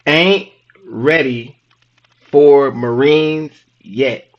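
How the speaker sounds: very slow, word-by-word delivery; drawn-out teasing reveal; deliberate punchline. Real, not acted. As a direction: spell it out slowly with pauses between words. Spelling out joke meaning of Army.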